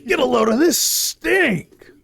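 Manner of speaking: high slow hyped shout; monster truck ad. Pretend. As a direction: bellow it laughing, ad-style. Ad parody, laughing.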